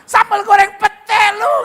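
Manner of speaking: hammed-up high shout, drawn out; mock angry. Comic cursing someone with food, screamed mock rage. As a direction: yell the dish as a furious insult. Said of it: "kalau marah".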